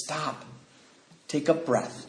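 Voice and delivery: very soft, quiet halt; hushed, steady instruction; calming command. Retold or imitated. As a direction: bring it right down to settle someone. Sponsor calms him down.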